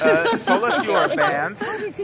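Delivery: high laughing voice. Real, not acted. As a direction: say it while laughing. Teasing ban announcement.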